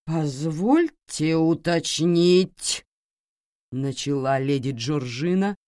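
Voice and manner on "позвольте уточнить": slow, drawn-out, louder; sing-song drawl. Acted. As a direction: stretch every syllable out. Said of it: "напевным тягучим голосом".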